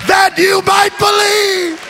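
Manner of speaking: rising to a loud, high shouted sermon; preaching at full force. Acted. Preacher shouting faith to the congregation, full-force preaching. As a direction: preach it out to the whole church.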